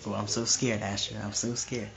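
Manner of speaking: low, quiet, deadpan "so scared"; unimpressed mock fear. Older sibling answering little sister's threat, unimpressed mock fear. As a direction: drop low and soft, humoring an empty threat. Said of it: "I'm not being sarcastic, but, you know, like that".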